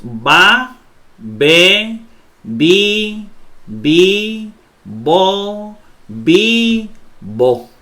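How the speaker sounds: Ba, be, bi, bi, bo, bi, bo, each syllable spoken separately and clearly, with a short pause after each; like a teacher leading a read-aloud, say each of Ba, be, bi, bi, bo, bi, bo distinctly. Shown as a demonstration. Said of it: "Entonces leamos".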